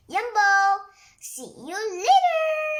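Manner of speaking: high, loud, slow sing-song goodbye; cheeky caterpillar farewell. Acted. Caterpillar calling goodbye from inside the peach, high and loud. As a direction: tease them as you dive in.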